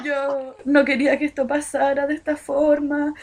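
a hammed-up crying boy begging forgiveness; mock tearful. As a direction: act out his sobbing apology, half-hammed. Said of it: "entre lágrimas".

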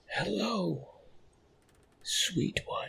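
high, breathy rasping whisper from a monster; strained creature whisper. Acted. Monster bending over the bed, strained whisper. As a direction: force out a whisper like it hurts. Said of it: "struggled to whisper to me".

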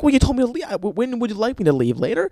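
high, exasperated comeback; exasperated retort. Acted. Boyfriend told to leave then blamed, exasperated retort. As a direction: argue back in disbelief.

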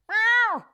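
high squeaky imitation meow; mock cat meow. Streamer imitating the cat meowing at the door, high squeaky meow. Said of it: "just doing this against the door".

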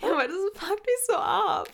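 high giggling complaint; laughing it out. Real, not acted. Sister laughs while saying what annoys her.

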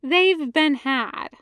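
ordinary delivery, nothing marked

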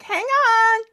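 frantic lover. Retold or imitated. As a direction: play a flustered lover fighting period clothes. Voicing a lover in old clothes.

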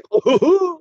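very high rising hoot; quick caricatured hoot; mock southern hooting. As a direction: squeal out a silly southern hoot. Southern hooting impression repeated.

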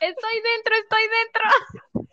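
thrilled to herself. Retold or imitated. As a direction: squeal it under your breath, barely holding it in. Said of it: "Y yo".

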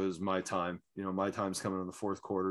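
low, soft, fast imitated mutter; muttering under breath. Drunk fan muttering threats during the game, low and soft. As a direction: grumble it to yourself like a brooding drunk. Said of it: "muttering under his breath".

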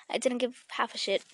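ordinary talk, nothing marked

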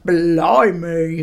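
hammed-up mock English guard's exclamation; bad English accent. Guard at the gate exclaiming, bad English accent. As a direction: put on a dodgy English accent, drawn out. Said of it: "American doing an English accent".

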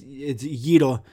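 "gyro" said Greek-style as YEE-ro, tapped r; Greek pronunciation. Shown as a demonstration. Telling how to talk to a Greek person, "gyro" said the Greek way, YEE-ro. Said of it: "if I'm talking to a Greek person".

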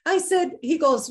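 ordinary talk

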